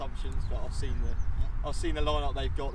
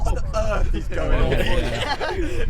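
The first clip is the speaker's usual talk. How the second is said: laughing; 8 semitones higher, 9 dB louder, much slower than usual. Real, not acted. Laughing at the chaos.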